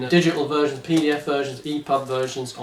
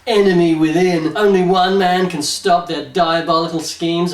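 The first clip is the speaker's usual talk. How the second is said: punchy back-cover narration, higher and louder; dramatic blurb read; 3 semitones higher, 4 dB louder than usual. Acted. Host reading the back of the book to listeners, dramatic blurb voice. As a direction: sell it like a thriller blurb.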